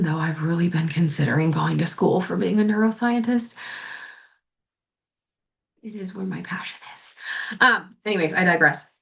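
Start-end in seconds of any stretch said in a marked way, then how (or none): 5.8-7.1 breathy secret whisper, much softer; whispered aside. Real, not acted. Confessing her passion to viewers in a whisper.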